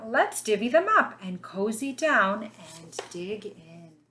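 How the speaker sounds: put-on lively storybook read for little kids; reading to children. A narrator's reading. Teacher reading a picture book to her class, lively storybook voice.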